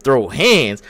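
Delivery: normal talking voice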